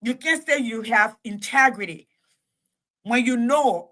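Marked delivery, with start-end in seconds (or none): none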